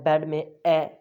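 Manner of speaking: ordinary delivery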